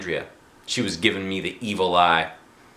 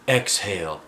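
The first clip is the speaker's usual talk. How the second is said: ordinary delivery, nothing marked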